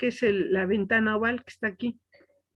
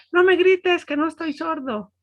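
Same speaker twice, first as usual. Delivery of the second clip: indignant grandpa; 7 semitones higher than usual. Retold or imitated. Grandpa scolding the shouting child, indignant. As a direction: snap back like an offended grandpa.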